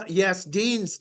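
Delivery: ordinary talk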